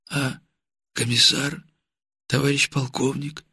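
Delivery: soft, hoarse, halting question; weak hoarse question. A narrator's reading. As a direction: ask weakly through numb lips. Weak wounded man asking.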